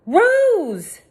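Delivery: desperate high shout of her name; calling out. A narrator's reading. Girl shouting at her unconscious friend. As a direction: cry her name to wake her.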